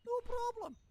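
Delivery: "No problem." leaps very high and loud; squeaky punchline. Acted. Game dwarf ending his boast, high and loud.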